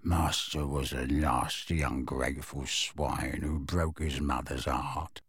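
resentful elf muttering insults about his master; undertone. A narrator's reading. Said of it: "continuing in an undertone".

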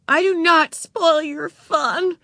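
put-on high, slow little-girl tantrum; storming. A narrator's reading. Ramona storming at her big sister, high and angry. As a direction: storm back, hitting every word. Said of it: "stormed Ramona".

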